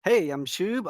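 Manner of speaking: ordinary talk, nothing marked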